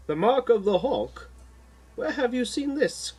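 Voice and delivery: Jay surprised by the symbol; surprised question. A narrator's reading. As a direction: lift it in sudden recognition. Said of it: "questions Jay".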